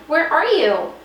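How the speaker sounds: put-on frightened baby's squeak. A narrator's reading. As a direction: call out in a small squeaky voice. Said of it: "squeaked Stella Luna".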